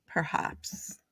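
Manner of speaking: put-on posh English accent, whispered; posh perhaps. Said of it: "did you hear that? I said perhaps".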